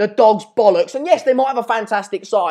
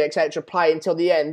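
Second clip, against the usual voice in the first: ordinary delivery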